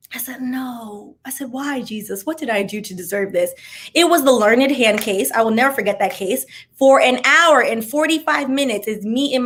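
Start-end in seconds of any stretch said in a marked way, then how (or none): 0.1-3.5 softly, hushed disbelief; quiet dread. Retold or imitated. Student called on first day, murmuring to herself in dread. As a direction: mutter it under your breath in dread.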